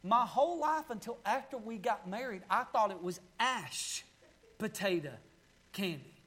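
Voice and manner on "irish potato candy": low, quiet Appalachian "ash" for Irish; "ash potato candy" in soft Appalachian accent; grandmother's Appalachian accent. Retold or imitated. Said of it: "my grandmother's got such an accent".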